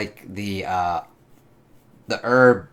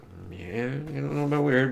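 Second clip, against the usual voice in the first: Ben, doubtful; 5 dB quieter than usual. Retold or imitated. Ben answering about weird faces, lightly voiced doubt. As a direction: mumble it like an unsure Ben. Voicing Ben's hesitant reply.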